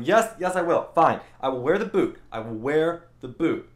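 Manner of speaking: exasperated, emphatic agreeing; insistent protest. Retold or imitated. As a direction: insist you will, hammering out each promise. Acted protest bargaining with the doctor.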